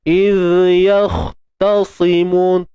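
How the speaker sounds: Contoh terpengaruh demo; wrongly lightened ta. Shown as a demonstration. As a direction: say Tasimun with the ta thinned by its neighbours. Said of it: "Dia sikit je".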